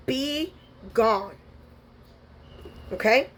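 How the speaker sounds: ordinary talk, nothing marked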